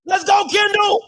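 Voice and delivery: loud high cheering yells; cheering shout. Real, not acted. Fan watching the game, shouting loud cheers. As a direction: yell it out for the team.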